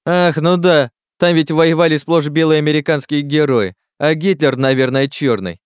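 high, loud mocking agreement; heated sarcasm. Klazen mocks Miller's war heroes, loud and sarcastic. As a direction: pile on sarcasm, dropping at the end.

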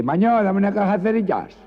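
grito muy alto y agudo de maño; grito baturro. Acted. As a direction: pide a voces como un baturro. Baturro gritando en el estanco. (maño pidiendo a gritos)

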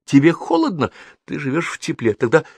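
mother's indignant self-answered questioning; exclaiming. A narrator's reading. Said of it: "воскликнула она".

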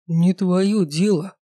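slow, curt refusal; curt cutoff. A narrator's reading. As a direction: shut her down, slowly. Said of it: "оборвал меня Дэй".

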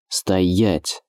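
rasped order, held in; understated hoarse order to halt; hoarse command. A narrator's reading. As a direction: croak out the command without raising the voice. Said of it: "прохрипел Эдуард".

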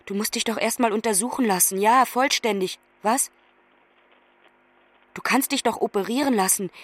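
ordinary talk

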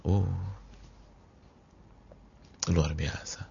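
ordinary talk, nothing marked

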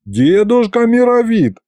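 high, drawn-out plea; pleading. A narrator's reading. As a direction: beg grandpa, stretching the words. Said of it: "взмолился я". (умоляюще, высоко и протяжно)

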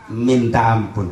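usual speaking voice

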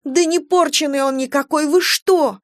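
high, distressed defence; upset, high-pitched disbelief; indignant protest. A narrator's reading. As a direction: cry out in the baby's defence. Wife defends baby, near tears.

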